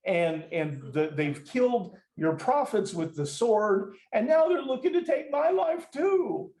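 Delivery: high, whining complaint with laughter; mock-whine like a spoiled kid; whiny. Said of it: "This is whiny".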